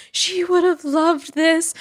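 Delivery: high, slow and far-off, as if thinking aloud; tender remembered thought. Retold or imitated. Voicing her own grieving thought.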